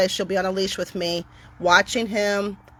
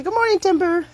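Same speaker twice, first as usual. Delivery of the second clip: much higher, sing-song good morning; cheery dog greeting; 11 semitones higher than usual. Shown as a demonstration. Showing viewers how to greet Timber, high and sing-song. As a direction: greet the wary dog like a friend.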